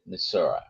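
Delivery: Missouri said as "Missour-uh", the local way; locals' pronunciation. Shown as a demonstration. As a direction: finish Missouri with an "uh" ending. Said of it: "many of the locals call it".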